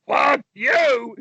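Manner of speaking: high-pitched defiant yell; yelling back. Acted. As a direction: yell it right back in his face. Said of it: "yell right back up".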